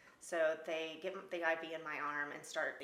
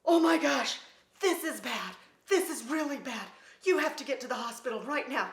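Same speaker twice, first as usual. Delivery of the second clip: loud, high panicked guide voice; alarmed tour guide; 7 semitones higher, 12 dB louder than usual. Retold or imitated. Tour guide seeing the snake bite, loud and panicked. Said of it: "this guy is like".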